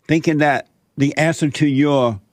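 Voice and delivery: normal talking voice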